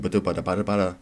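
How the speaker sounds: "bada bada bada bada" as quick nonsense syllables; mock stumbling over "bada bada bada bada"; playful garbled pronunciation. Shown as a demonstration. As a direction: babble "bada bada bada bada" in place of the word. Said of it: "however you pronounce".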